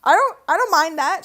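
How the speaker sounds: high and loud, pleased; excited approval. Real, not acted. Fan approving a shot on stream, excited. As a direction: cheer it on. Reacting to play.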